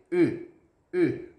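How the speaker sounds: "E" as an O pushed into a pout; isolated French "E", pouted. Shown as a demonstration. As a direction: say "E" with tightly rounded lips, like "eu". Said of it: "falando um O e um biquinho".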